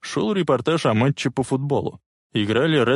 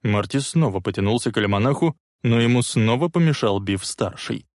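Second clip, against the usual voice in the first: ordinary delivery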